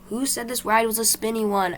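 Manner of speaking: put-on girl whining, dizzy on a ride; whine. A narrator's reading. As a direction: whine about the spinning ride. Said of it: "Adeline whined".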